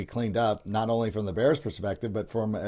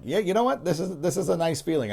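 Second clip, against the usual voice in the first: higher-pitched, pleasantly surprised fan voice; pleased fan; 7 semitones higher than usual. Acted. Fan reacting to a tied game rather than trailing, pleased and high-pitched. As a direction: lift the voice high, as if pleasantly relieved. Voicing a relieved fan's reaction.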